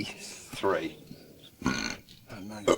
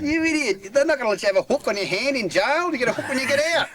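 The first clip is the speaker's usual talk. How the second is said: mocking officers; 12 semitones higher, faster than usual. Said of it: "And they said".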